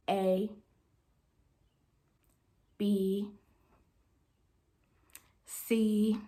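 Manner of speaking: soft, low letters with long pauses; imitating exaggeratedly slow dictation; painfully slow reading. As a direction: spell it out one letter at a time. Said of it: "she reads it so slow".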